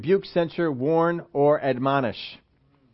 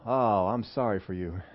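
mock pity; 9 semitones lower, 8 dB quieter than usual. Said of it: "share your excitement of church".

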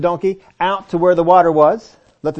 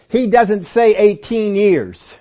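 slow, louder stress on "eighteen years"; emphatic correction; 5 semitones higher, 5 dB louder, much slower than usual. Real, not acted. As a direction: spell it out high and deliberate, word by word. Correcting the translation.

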